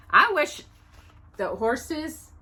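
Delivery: usual speaking voice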